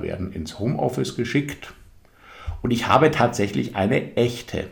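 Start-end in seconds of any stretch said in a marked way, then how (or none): 3.7-4.7 "echte" lifted higher and set apart; stress on echte. Real, not acted. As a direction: punch the word "echte" with a raised pitch. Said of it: "ich betone echte". („echte“ mit angehobener Stimme betont)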